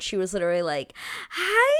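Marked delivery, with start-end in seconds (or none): 1.3-1.7 mock awkward squeaky hi, much higher and louder. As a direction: imitate a shy girl's awkward hello. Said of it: "She was so awkward".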